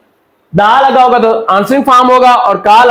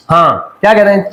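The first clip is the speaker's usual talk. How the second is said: normal talking voice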